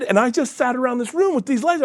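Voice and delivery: usual speaking voice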